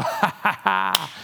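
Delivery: mock jeering ha ha ha; a hammed-up scornful chuckle; mocking laugh. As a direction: laugh her off like a scornful crowd. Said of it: "and they all go".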